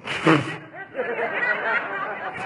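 scoffing laugh. Acted. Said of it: "The servant's like".

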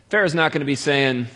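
ordinary delivery

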